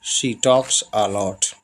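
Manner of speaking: each English word spaced out slowly; slow dictation. Shown as a demonstration. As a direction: read it slowly for the learners. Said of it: "या वह बहुत बात करती है".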